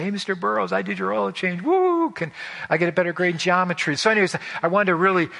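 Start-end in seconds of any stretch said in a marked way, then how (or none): 0.0-3.9 much higher, bouncy, with a whooping woo; a proud kid showing off to his teacher; eager teen bragging. Retold or imitated. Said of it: "I wanna go in the home next day and say".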